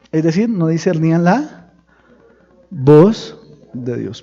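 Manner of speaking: loud, slow, booming preaching; preaching at full force. Real, not acted. Pastor thundering to his congregation. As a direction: thunder it out to the church.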